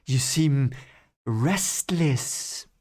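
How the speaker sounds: lightly voiced smooth count, pausing between words; hint of an evil count, drawn out; Barthanes. Barthanes addressing Rand, in a lightly put-on count's voice. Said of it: "that's my Barthanes impression now".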